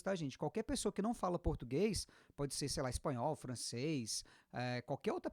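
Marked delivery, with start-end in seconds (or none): none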